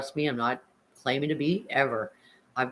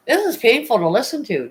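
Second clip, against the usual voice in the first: pained outcry; 9 semitones higher, 6 dB louder than usual. Real, not acted. Reacting to painful story.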